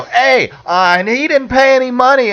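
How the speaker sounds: high mock tattling whine; whiny handler. Handler complaining to George, high mock whine. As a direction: tattle on him, high and petty. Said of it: "starts walking over going".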